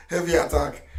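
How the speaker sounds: chuckling through heavy attack; amused, half-laughing. Real, not acted. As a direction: laugh out the words. Laughing while speaking.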